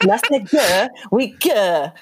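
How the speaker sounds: lightly put-on bad Cockney accent; a hammy, half-hearted Cockney accent; terrible Cockney accent. Quoting the family's Spice World joke in bad Cockney.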